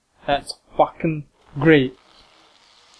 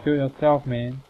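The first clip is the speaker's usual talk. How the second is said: usual speaking voice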